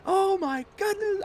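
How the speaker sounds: very high panicked babble; retelling his own freak-out, high and frantic; imitating his panicked self. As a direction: squeal it out in mock terror. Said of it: "I'm like".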